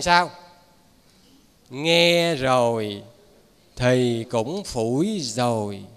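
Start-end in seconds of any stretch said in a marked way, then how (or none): 1.7-5.8 very slow, low, drawn-out words; slow verse recital. Shown as a demonstration. Preacher recites verse on those who ignore teaching, slow low chant. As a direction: intone the line slowly, stretching each word.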